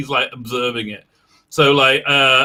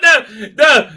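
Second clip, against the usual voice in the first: mock horrified no; 7 semitones higher, 6 dB louder than usual. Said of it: "And it's like".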